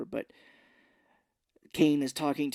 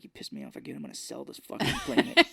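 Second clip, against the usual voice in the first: mock whisper threat; faster than usual. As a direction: hiss the threat under your breath. Mock heroine muttering threat.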